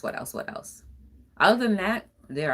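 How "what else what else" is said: each repeat softer till whispered; whispered self-question as she fades out; fading to a whisper. Real, not acted. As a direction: trail off musing to yourself. Repeats while thinking, fading.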